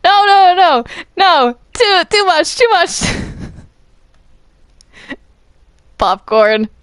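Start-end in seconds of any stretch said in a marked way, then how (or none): 0.0-3.0 high, loud no no no, too much; loud protest. Real, not acted. Yelling at Popcorn to stop, much higher and louder. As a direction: cry out to make it stop. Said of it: "Quit it".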